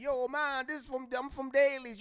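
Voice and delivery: friend putting on a put-on island accent; mock Jamaican accent. Friend from the block faking a Jamaican accent, mild mock Jamaican.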